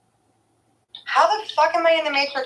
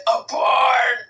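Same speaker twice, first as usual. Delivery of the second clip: mild mock shout; 5 semitones higher, 4 dB louder than usual. Shouting answer as catchphrase.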